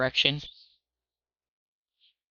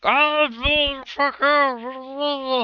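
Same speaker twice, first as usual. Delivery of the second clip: high, loud, garbled yell with a stuffed mouth; muffled shouting; 11 semitones higher, 4 dB louder, much slower than usual. Acted. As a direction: shout through a mouthful of food. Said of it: "starts shouting something, and it's horribly muffled".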